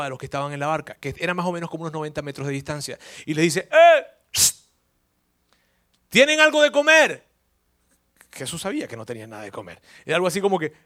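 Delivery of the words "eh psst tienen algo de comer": high, loud call across the water; hailing fishermen from the shore. Retold or imitated. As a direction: shout out to the boat far off. Jesus calling across ninety meters.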